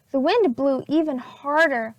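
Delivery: ordinary delivery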